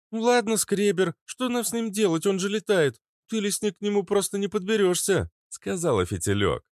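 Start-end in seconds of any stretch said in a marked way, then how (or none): 0.1-5.3 female character voice. Acted. Fitilyok speaks.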